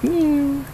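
high mock cat meow, lightly rasped; imitating raspy meow. Owner imitating his cat's old meow, high mock meow. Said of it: "he used to have that raspy meow".

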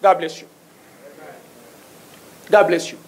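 normal talking voice

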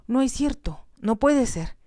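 high, pleading denial; desperate disbelief. A narrator's reading. Jocelyn denies the news of Terry, desperate. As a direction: refuse to believe it, voice climbing. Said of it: "Se volvió desesperada".

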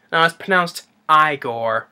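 "Igor" said as EYE-gor; corrected pronunciation. Retold or imitated. Igor correcting the doctor, "Igor" slowly as EYE-gor. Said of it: "it's pronounced Igor".

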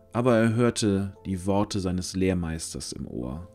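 ordinary delivery

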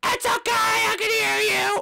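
shrieked hello, loud and drawn out; [name] screaming an over-eager hello; screaming greeting. Acted. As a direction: yell your name right in his face. [name] keeps yelling.

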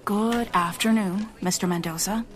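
usual speaking voice

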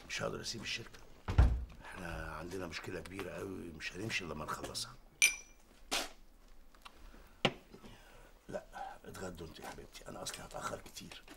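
low, soft, hurried; hushed voice. Real, not acted. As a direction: keep it quiet in a meeting. Father on phone in meeting.

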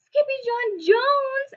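high drawn-out group cry. Acted. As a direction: everyone cries out his name. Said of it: "everyone cried".

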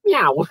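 a plainer meow at normal pitch; cat meow, second try. Pretend. Host retrying his cat impression, plain-pitched meow. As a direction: try the meow again in your own voice. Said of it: "That's maybe better".

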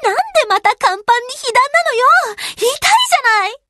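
high, frantic whining cries; distressed, high-pitched outburst; panicked protest. Acted. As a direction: panic and complain as you take a hit. Hit on the flight deck, panicking.

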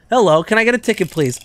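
lightly voiced fast customer. Voicing a customer.

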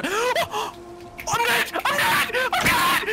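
high panicked yelling; panicked scream. Real, not acted. Killed by mob, screaming.

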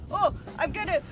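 ordinary talk, nothing marked

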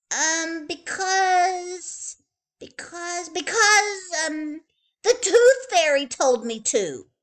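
put-on high, halting little-girl voice stumbling on repeats; stalling child. A narrator's reading. Little girl stalling before her mother, high and halting. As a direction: stammer while inventing an excuse.

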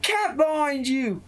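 very high-pitched character yell, drawn out; acted warning yell. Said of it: "Katara's gonna yell".

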